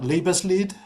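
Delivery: Liebeslied said with a German accent, LEE-bes-leet. Shown as a demonstration. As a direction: give Liebeslied its German vowels and a final t sound. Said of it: "pardon my German accent".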